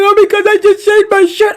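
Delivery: high, loud imitation of a wailing boy; mock crying child. Boy explains to aunt why he's crying, mock tearful wail. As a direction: mimic a kid whining through tears. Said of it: "he starts to cry".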